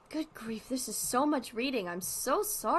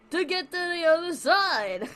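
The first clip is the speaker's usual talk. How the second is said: higher, louder Brooklyn comic dog; Brooklyn punchline; 9 semitones higher, 8 dB louder than usual. Acted. Barnaby delivering the punchline, loud Brooklyn accent. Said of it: "He's turning Brooklyn".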